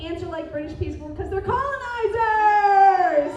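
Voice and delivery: loud, high shout of the punchline; retelling a friend's joke with a loud payoff. Retold or imitated. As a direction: yell "colonizers" as the big finish.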